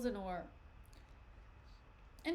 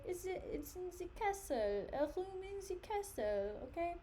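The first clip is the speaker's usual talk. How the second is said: hammed-up French accent, higher pitched; playful French accent; 8 semitones higher than usual. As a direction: say it in a jokey put-on French accent. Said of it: "My French accent just, like, sent me into a frenzy".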